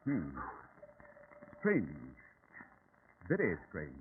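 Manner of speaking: low, soft musing; pensive murmur. Acted. Holmes puzzles over the vanished witch, quietly musing. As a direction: think it over half to yourself.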